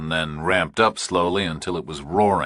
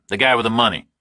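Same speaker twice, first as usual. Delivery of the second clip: louder, higher, tense; tense Burke; 6 semitones higher, 5 dB louder, faster than usual. A narrator's reading. Burke answering the kidnapper, tense and louder. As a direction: push through nerves.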